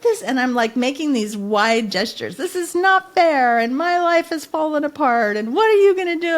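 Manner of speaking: high, loud, crying out at God; retold yelling at God. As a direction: shout it up at the sky in despair. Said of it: "I am yelling at the top of my voice".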